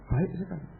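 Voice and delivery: ordinary talk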